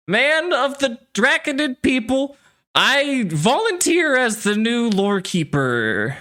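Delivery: put-on high, slow, drunken proclamation; drunk young man. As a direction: drawl it out like a drunk volunteering for glory. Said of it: "by a drunk, heavy-breathing".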